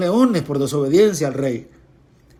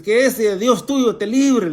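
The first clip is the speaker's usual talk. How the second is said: slow, higher call to Daniel; king's cry; 5 semitones higher, slower than usual. Acted. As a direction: cry it out slowly. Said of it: "el rey le grita a Daniel". (grito lento y agudo del rey a Daniel)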